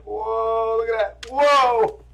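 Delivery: high, loud whooping wonder; loud, high-pitched playful amazement; playful whoa. Real, not acted. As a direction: hype up the dog with a big whoa. Lifting the dog in one hand.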